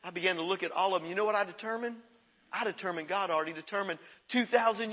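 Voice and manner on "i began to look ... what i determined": ordinary talk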